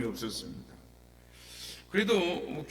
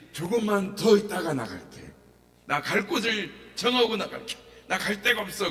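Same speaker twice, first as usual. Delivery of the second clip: pleading bargain; 5 semitones higher, 5 dB louder than usual. Retold or imitated. Demon bargaining.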